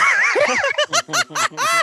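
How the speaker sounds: very high shrieking laugh; burst of laughter. Real, not acted. Remembering the flustered driver, real loud high laugh.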